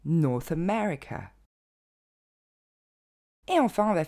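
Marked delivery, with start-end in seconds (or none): 0.1-1.3 North America à l'anglaise, lentement, ME bien marqué; accent sur ME. Shown as a demonstration. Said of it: "on reprend les deux". (North America, accent secondaire sur North)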